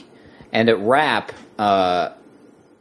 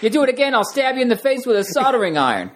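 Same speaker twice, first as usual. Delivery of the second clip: much higher, exaggerated actor impression; Christopher Walken; 12 semitones higher than usual. Contestant takes a turn at the movie line, high-pitched impression. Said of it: "your best Christopher Walken impression".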